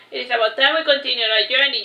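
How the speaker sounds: ordinary delivery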